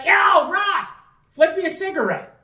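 high, carrying imitation of a holler; calling out. Retelling brother hailing friend across the lake, calling out. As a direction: call across the water to a friend.

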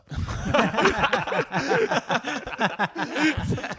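high loud helpless laugh; burst of laughter. Real, not acted. Laughing hard at the gun guy's earnest answer. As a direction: crack up hard.

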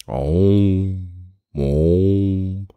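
long, droning "om" held on one note; chanted oracle "om"; oracle's trance hum. Acted. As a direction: intone a drawn-out meditative hum. Oracle hums before answering.

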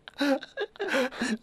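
high, giddy giggling; excited giggle. Real, not acted. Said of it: "[name] is giggling with excitement".

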